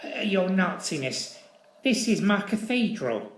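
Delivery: nervous, pleading little man; flustered squirt. Acted. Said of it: "the little squirt floundered".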